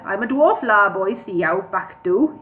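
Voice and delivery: lilting mock-Welsh dwarf; bad Welsh accent. Dwarf introducing himself to Bingo, bad Welsh accent. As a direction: introduce yourself in badly faked Welsh. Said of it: "I can't do a Welsh accent".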